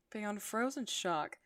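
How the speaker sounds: plain reading voice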